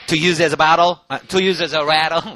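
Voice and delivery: loud, slow "to use as a rattle"; loud drill line. Shown as a demonstration. Teacher leads the full-line drill, loud and slow. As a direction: push the phrase out for repeating. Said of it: "다시 한번 또 하죠".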